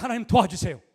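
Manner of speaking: urgent loud prayer; loud plea. Shown as a demonstration. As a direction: call out "하나님, 도와주세요" with force. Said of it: "그때 딱 기도해".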